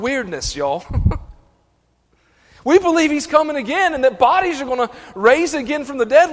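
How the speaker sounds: loud, high, driving sermon climax; full-force preaching. Real, not acted. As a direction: preach it hard to the congregation.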